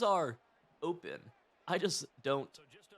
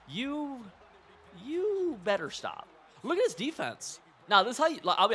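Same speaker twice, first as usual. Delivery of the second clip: mock scolding; 9 semitones higher, 6 dB louder than usual.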